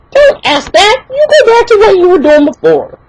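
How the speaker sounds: high-pitched irritated telling-off; exasperated retort. Retold or imitated. Customer dismissing the nosy cashier, exasperated high-pitched retort.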